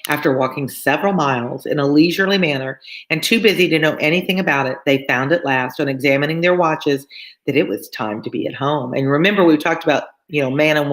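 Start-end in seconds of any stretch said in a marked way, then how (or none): none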